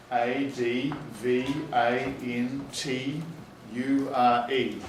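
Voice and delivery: letters spelled out slowly; slow school dictation. Shown as a demonstration. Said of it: "teacher demos spelling aloud".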